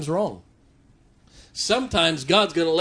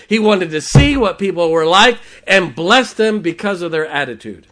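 louder, driving sermon cadence; preaching at full force; 3 semitones higher, 8 dB louder than usual. Acted. Preacher listing Bible trials to the church, building loud. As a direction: hammer each line to the congregation.

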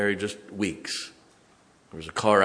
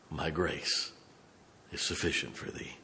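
soft whisper; 3 semitones lower, 9 dB quieter than usual. Acted. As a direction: drop to a hushed, unvoiced whisper. Quoting God's words softly.

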